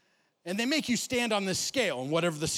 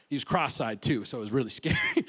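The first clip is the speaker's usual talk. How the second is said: ordinary talk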